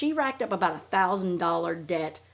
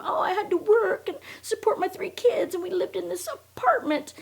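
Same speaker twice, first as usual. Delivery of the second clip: much higher put-on whiny voice, whispered at the end; mocking Robin; 12 semitones higher than usual. Mocking Robin's hard-luck story to viewers, high mock whine. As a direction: parody her sob story. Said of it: "she comes from".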